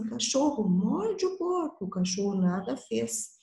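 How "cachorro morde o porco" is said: teacher voicing the old woman asking the dog to bite the pig, high and pleading; old lady pleading. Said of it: "pediu pro cachorro assim".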